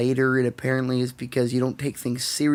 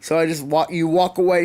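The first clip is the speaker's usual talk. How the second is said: ordinary delivery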